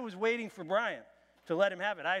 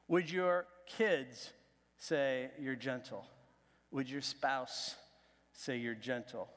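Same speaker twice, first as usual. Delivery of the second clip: slow searching questions; 4 semitones lower, slower than usual. Real, not acted. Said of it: "slow probing questions".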